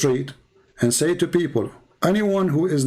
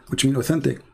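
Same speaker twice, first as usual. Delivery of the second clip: usual speaking voice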